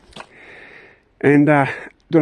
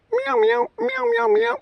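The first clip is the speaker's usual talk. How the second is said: very high squeaky meowing like a cat; high-pitched kitty meows. Pretend. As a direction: meow up at the cat in a squeaky falsetto. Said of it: "Now I sound like that crazy flippin' cat lady".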